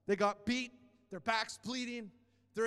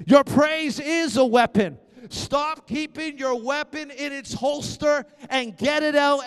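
full-force preaching; 3 semitones higher, 14 dB louder than usual. Acted. Preacher rallying the congregation to praise, in a loud driving cry. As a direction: preach it out to rouse the church.

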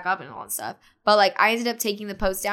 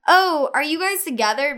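lightly mocking, higher and louder; chirpy imitation of another girl; mimicked friendly ex; 6 semitones higher, 5 dB louder than usual. Ex-friend coming up behind them, lightly imitated nosy chirp. Voicing his ex-friend's greeting.

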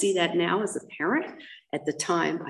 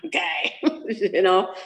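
normal talking voice

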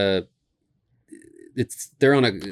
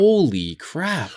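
slow, higher shocked exclamation; drawn-out disbelief; 9 semitones higher, much slower than usual. Real, not acted. Shocked by store search results.

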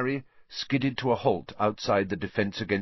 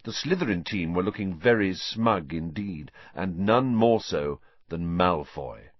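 ordinary delivery, nothing marked

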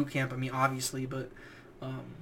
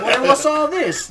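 high, loud retold bark with a laugh breaking through; mock drill instructor yell; 10 semitones higher, 10 dB louder than usual. Drill instructor catching a recruit stealing tape, laughing mock yell. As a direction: ham up the shouting sergeant while laughing.

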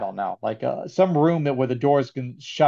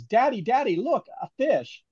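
higher, softer mock child voice; imitated little girl; 8 semitones higher, 3 dB quieter than usual. Retelling a movie girl spotting the shark from the tunnel, imitated child. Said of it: "a little girl goes".

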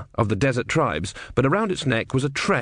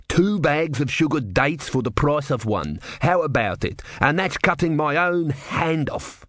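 slow, higher-pitched hawker's bargain; pleading street seller; 5 semitones higher than usual. Acted. Dejected man with the tray offering a bargain, hawking. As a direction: press the deal, pitch climbing at the end.

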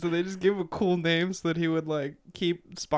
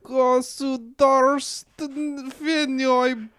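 put-on slow, careful Russian accent; 8 semitones higher, 7 dB louder, much slower than usual. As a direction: draw out the Russian words in a Russian accent. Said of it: "Your Russian accent today is on".

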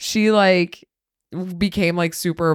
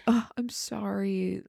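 mock whiny apology; 10 dB quieter, slower than usual. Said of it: "to be like".

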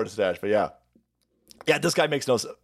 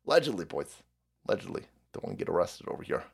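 dropped to a near whisper; hushed aside; 10 semitones lower, 9 dB quieter, faster than usual. Real, not acted. Streamer covering himself to his chat, hushed whisper. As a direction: duck the voice down, quiet and fast. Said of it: "covering himself, dropping his voice".

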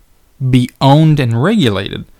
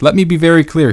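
higher, louder impression of Bernie Sanders' Brooklyn accent; Bernie Sanders accent; 5 semitones higher, 5 dB louder than usual. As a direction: put on Bernie's Brooklyn accent, raised and loud. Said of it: "This is what Bernie Sanders said".

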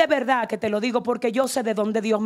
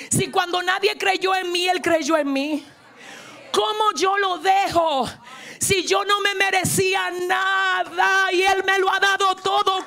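raised, high-pitched pulpit cry; impassioned preaching; 6 semitones higher than usual. Real, not acted. Preacher urging the church to adore and never leave God, impassioned preaching. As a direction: preach with fervor, building to a shouted call.

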